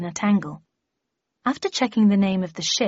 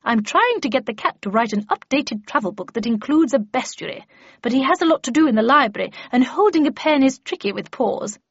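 brisk, louder, higher lady's voice; animated character voice, pitched up; Miss Havisham; 5 semitones higher, 4 dB louder than usual. Acted. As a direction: chatter on with busy authority.

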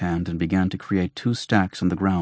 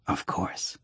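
whispered; 10 dB quieter than usual. Acted. Doctor's knowing aside.

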